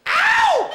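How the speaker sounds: high, loud "ow" holler; Calgary whoop. Retold or imitated. Comic imitating Calgary crowds, high yelping whoop. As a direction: yelp it like a rowdy crowd. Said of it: "Except in Calgary".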